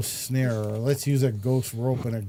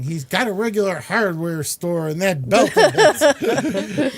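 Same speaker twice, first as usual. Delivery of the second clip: higher, louder Catwoman line; quoting Catwoman; 6 semitones higher, 7 dB louder than usual. Retold or imitated. Fan quoting Catwoman's line about Batman's belt, raised mock voice. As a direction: toss off the quote in a raised mock voice. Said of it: "you have Catwoman saying".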